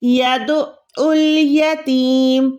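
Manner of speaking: यदउल यतीम with a caught, held ain after "yadu"; slow chanted यदउल यतीम, long "teeem"; doubled ain. Shown as a demonstration. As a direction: recite यदउल यतीम slowly, squeezing the throat mid-word. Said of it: "कैसे अदा करना है".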